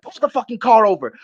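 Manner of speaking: high-pitched, urgent demand; retold anger. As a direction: re-enact a mad passenger demanding a stop. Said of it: "I was so fucking mad".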